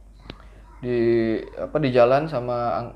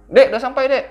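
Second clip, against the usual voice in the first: loud, high call from the angkot driver; 10 semitones higher, 7 dB louder than usual. Retold or imitated. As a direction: call the kid to get off, loudly.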